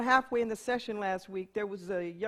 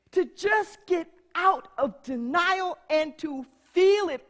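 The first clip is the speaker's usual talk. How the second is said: loud, slow, fervent preaching with long pauses; full-force preaching; 6 semitones higher, 12 dB louder, slower than usual. Acted. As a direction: preach it to the back of the hall.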